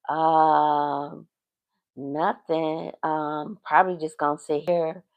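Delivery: low, very soft imitation of him; hesitant mumbled reply. Man telling her he has no plans, hesitant and low. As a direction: voice him quietly, dragging out the ums. Retelling the man's noncommittal answer.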